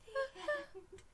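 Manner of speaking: high, soft little voice; tiny, plaintive sign-off; mock sad ending. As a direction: say it faintly, like a sad storybook close. Imitates the film's bleak ending.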